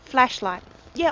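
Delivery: ordinary talk, nothing marked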